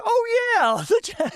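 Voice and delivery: very high mock "aha" agreement; dawning agreement. Everybody agreeing with the shrimper, dawning agreement. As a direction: imitate a crowd suddenly catching on. Whole class voiced as catching on.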